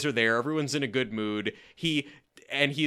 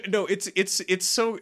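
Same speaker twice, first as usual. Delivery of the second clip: normal talking voice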